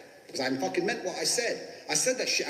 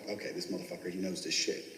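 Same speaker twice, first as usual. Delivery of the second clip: much lower and softer, grudging approval; grudging inner thought; 8 semitones lower, 5 dB quieter than usual. Retold or imitated. Interviewers thinking about the cocky candidate, low and soft. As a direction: drop low and quiet, grudgingly impressed.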